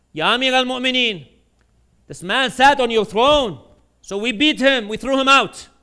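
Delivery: loud, high, emphatic acted report; guard reporting to the ruler. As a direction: proclaim it to the ruler as his guard. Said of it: "The guards say".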